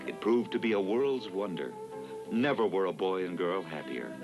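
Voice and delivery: ordinary delivery, nothing marked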